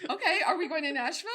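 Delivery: high, loud, rapid eager question; gung-ho imitation, nearly shouting through laughter; Alexis. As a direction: play her raring to go, fast and loud. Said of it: "Now that would be Alexis".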